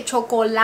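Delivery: CHOCOLATE said in Spanish, cho-co-LA-te; every vowel pronounced. Shown as a demonstration. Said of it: "you need to pronounce every vowel".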